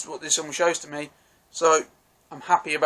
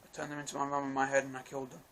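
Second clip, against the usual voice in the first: softer, fast, flat confession; a lightly voiced meek villain admitting it; quiet deadpan confession; 15 dB quieter, faster than usual. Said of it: "Polka Dot Man just goes".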